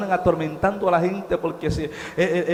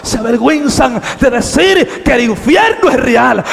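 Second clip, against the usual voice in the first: shouted proclamation; 6 semitones higher, 11 dB louder than usual. Acted. Shouted repeat of quiet line.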